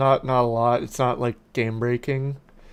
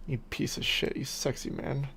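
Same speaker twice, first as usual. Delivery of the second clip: much softer, breathy teasing whisper; whispered aside; 11 dB quieter than usual. Pretend. Streamer whispers a mock insult to friend. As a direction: whisper it like a secret.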